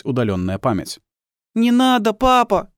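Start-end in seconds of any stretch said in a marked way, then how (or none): 1.6-2.7 put-on very high, loud child's plea; scared child pleading; frightened little boy. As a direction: beg your father in a small boy's high voice. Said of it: "со страхом смотрит на отца".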